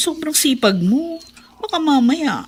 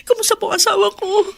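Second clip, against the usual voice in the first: higher, crying voice asking; tearful plea; 11 semitones higher, 4 dB louder than usual. Acted. Wife asking the doctor about her husband, tearful. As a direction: beg the doctor through tears.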